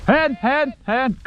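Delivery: calling a dog. Real, not acted. Hunter directing his bird dog.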